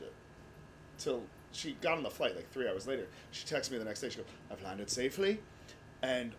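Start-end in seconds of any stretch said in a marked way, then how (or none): 4.5-5.4 imitated Irish nanny, rising on the marvelling part; Irish accent. Nanny texting her grandchild after the flight, marvelling Irish accent. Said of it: "Your Irish is stunning".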